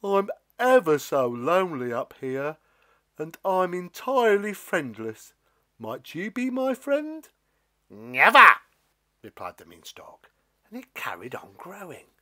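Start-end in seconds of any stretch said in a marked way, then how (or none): 0.0-7.3 shy, pleading giant voice; hope he'll say yes; a friendless giant asking nicely; lonely giant. Acted. Lonely giant figure speaks. 7.9-8.6 loud refusal. Acted. Stalk refuses giant.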